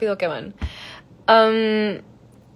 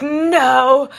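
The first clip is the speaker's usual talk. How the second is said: retold scream, louder but held back; mock disbelieving scream; 3 semitones higher, 5 dB louder than usual. Retelling hearing her voice in the trailer, mildly screamed. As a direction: imitate a stunned, drawn-out outcry. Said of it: "I literally screamed".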